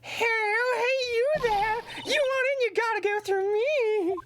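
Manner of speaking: very high, shrill-pitched mock Queen guard. Streamer voicing the security guard lady as the Queen. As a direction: screech it out like a pompous old queen. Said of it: "I think the Queen would be funnier".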